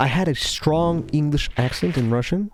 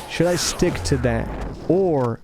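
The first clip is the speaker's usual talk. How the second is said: ordinary delivery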